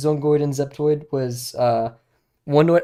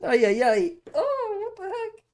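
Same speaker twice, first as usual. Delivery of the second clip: very high voice. Shown as a demonstration. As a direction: back off the mic and go really high. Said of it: "That was just my voice going that high".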